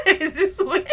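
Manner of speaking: words squeezed out through mocking laughter; laughing loudly at your own joke. Real, not acted. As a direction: crack up high while describing the teeth. Mocking their teeth.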